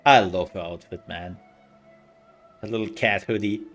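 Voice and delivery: ordinary delivery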